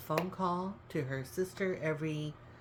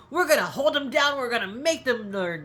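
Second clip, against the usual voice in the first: mock tough talk; 7 semitones higher, 9 dB louder than usual. Mock threat, joking about family.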